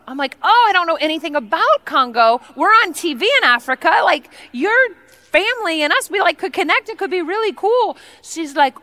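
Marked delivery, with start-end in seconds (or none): 0.4-8.0 high-pitched, eager gush; gushing enthusiasm. Retold or imitated. Guest gushes to the Congolese tea lady, high excited chatter. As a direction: bubble over at a new connection. Acts out her gushing reply.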